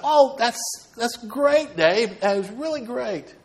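high-pitched, effusive mock congratulations; playfully gushing, voice lifted high; retold congratulations. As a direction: act out being delighted for a friend. Acts out congratulating his friend.

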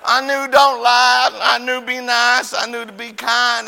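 loud, high, drawn-out mock wail; hammed-up pleading recital; punished child. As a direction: wail the lessons as if being spanked. Punished child wails out lessons.

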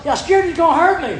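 high, loud mock-scared protest; retold fright. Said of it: "Well, I was scared".

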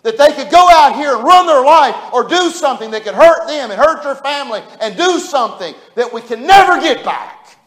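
high, loud pulpit preaching; full-force preaching. Acted. Preacher warning his congregation, loud fervent preaching. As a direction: preach it out with fire.

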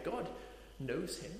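ordinary talk

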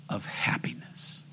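very soft, whispered last phrase; hushed whisper. Real, not acted. Preacher closing the message before prayer, whispered. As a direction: let it fall to a whisper.

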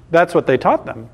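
normal talking voice

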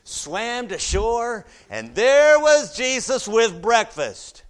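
loud, high, driving preacher's voice; full-force preaching. Real, not acted. Preacher rousing the church over the verse, loud and high.

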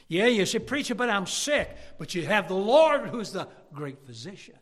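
loud, high answering preacher; full-force preaching. Acted. Preacher rebutting the congregation's complaints, loud and high. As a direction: answer every complaint with fire.

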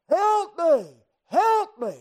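high, loud acted plea for rescue; crying out. Child in the pool calling for rescue, crying out. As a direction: yell it as if in danger. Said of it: "and they're crying out".

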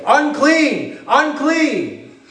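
a leper crying a warning to the crowd; leper's warning cry. Retold or imitated. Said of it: "would have to proclaim".

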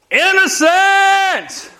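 high, slow proclaimed verdict; crying out innocent. Acted. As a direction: ring it out, drawn long. Blood cries out, acted verdict.

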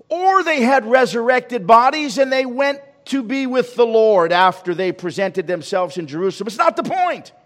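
high, loud sermon peak; preaching at full force. Acted. Preacher answers the congregation's question, full-force preaching. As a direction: drive the point home at full voice.

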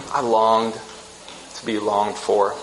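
slowed, softened echo. Retold or imitated. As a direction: linger on each word the second time.

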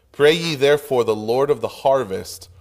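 ordinary delivery

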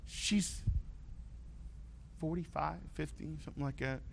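high, then fading to a hush, half-joking excuse; teen pleading. Retold or imitated. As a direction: plead with dad, dropping to a secret hush.

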